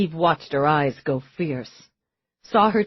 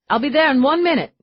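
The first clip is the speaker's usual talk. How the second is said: rushed promise; 9 semitones higher, 6 dB louder, much faster than usual. Acted. Promising to hurry over.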